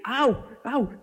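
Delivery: high, playful "ow"; mock pained yelp. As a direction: yelp as if deafened by the children. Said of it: "Casi me dejan sordo". (quejido agudo fingido de dolor)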